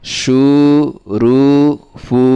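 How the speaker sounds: measured, chanting recital of spelled syllables; recitation drill. Shown as a demonstration. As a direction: read each syllable out slowly like a teacher drilling a class. Revision recital. (ধীরে ধীরে সুর করে বানান পড়া)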